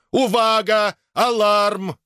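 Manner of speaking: very high, monotone alarm yelling; frantic yell. A narrator's reading. Robot yelling warnings, frantic high cry. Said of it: "истошно заорал сэр Найджел".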